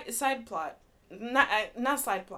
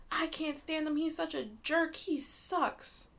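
higher, mock-annoyed griping; exasperated rant; 8 semitones higher, slower than usual. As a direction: vent about the jerk, pitched up. Complaining about a book character.

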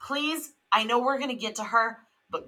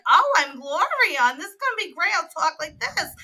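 ditzy Gloria accent; 10 semitones higher, 7 dB louder than usual. Shown as a demonstration. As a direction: ham up Gloria's put-on stage accent, higher and louder. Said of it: "And I talked like that".

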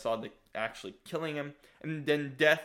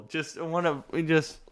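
normal talking voice

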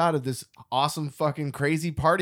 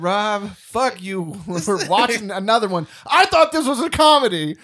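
exasperated; 6 semitones higher, 6 dB louder, slower than usual. Retold or imitated. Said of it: "And Tony said".

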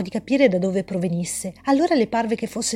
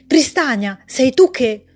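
loud hopeful call, breaking off; 2 semitones higher, 6 dB louder, slower than usual. Acted. Alice calling out to Tristania, loud call. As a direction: shout her name then cut yourself short.

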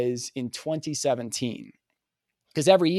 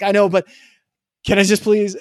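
higher, louder desperate plea; urgent pleading; 7 semitones higher, 10 dB louder than usual. Retold or imitated. Pleading with Sean's wife to reach Sean, high and loud. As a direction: beg her to let you through, pitched up and loud.

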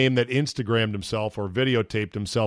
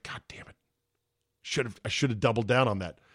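muttered regret; 3 semitones lower, 7 dB quieter than usual. Retold or imitated. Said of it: "he's like".

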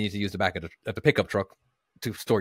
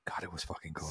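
whispering; 6 semitones lower, 17 dB quieter than usual. Real, not acted. Cold winter, hushed aside.

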